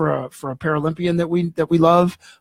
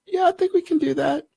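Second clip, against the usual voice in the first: higher-pitched, obliging woman's voice; voiced nurse; 12 semitones higher, 3 dB quieter than usual. Nurse replies to the hungry patient, imitated higher voice. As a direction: lightly imitate a nurse agreeing to a request. Nurse gently answers his food request.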